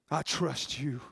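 David praying alone, softly saying "I trust you"; quiet prayer. Acted. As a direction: breathe "I trust you" to God.

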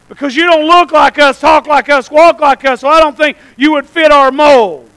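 loud, emphatic pulpit preaching. Acted. Preacher warns churches would keep Jesus from the pulpit, loud preaching. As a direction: drive each phrase out to the congregation.

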